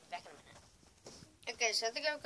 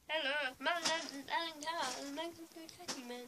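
higher-pitched put-on chat show host; Alan Carr; 6 semitones higher than usual. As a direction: open the show in a high host impression. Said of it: "That's another voice I'm making".

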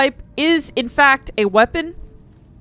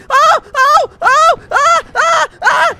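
loud high panicked oh and ah cries; panicked yelps; 6 dB louder, slower than usual. Real, not acted. Gamer surrounded by zombies, yelping in panic. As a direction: yelp in rising terror. Trapped, getting hit.

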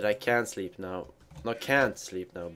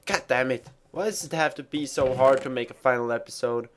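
ordinary talk, nothing marked

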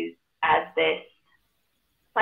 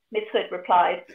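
ordinary talk

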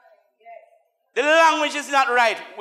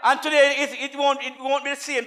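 normal talking voice

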